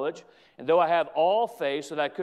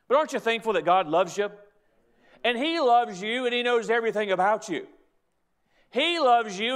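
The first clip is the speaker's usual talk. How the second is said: impassioned pulpit delivery, slowing on the big words; rising preaching fervour; 3 semitones higher than usual. Real, not acted. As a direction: lift the voice and press each promise home to the congregation.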